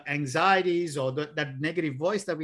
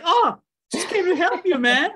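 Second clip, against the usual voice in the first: put-on high, loud, wounded little-kid voice; hurt eight-year-old child; 14 semitones higher, 6 dB louder than usual. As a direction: protest like an upset small boy, pitched way up. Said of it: "if I meet him with".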